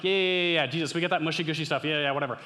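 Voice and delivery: loud, hurried brush-off; dismissive. Acted. Brushing Jesus off in imagined reply.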